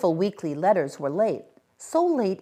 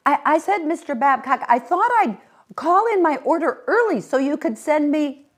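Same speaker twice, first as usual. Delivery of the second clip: raised voice; 11 semitones higher, 7 dB louder than usual. A narrator's reading. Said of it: "raised her voice a little".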